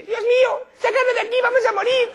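very high, loud squeal; hammed-up squeaky terror; tiny panicking hair. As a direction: squeal for rescue like a tiny creature. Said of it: "oír como el pelo dice".

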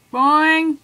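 bouncy, loud cartoon sound effect; loud boing. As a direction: sing out a springy boing. Voicing a bounce in game.

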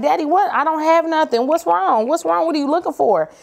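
high, whiny fake innocence; playing innocent. Daughter hiding her tattoo from dad, whining innocently. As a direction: plead with daddy like you've done nothing.

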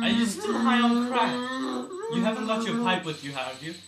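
loud teasing shout; mock scolding shout. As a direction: yell it at the patient, half laughing. Yelling at patient in game.

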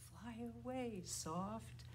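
hushed, gentle call; soft. Shown as a demonstration. Teacher sending the bird named Soft away, softly.

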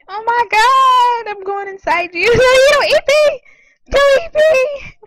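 high, loud panicked cries. Real, not acted. Gamer about to be eaten in the game, panicked yelling. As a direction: yell it as you are about to be swallowed.

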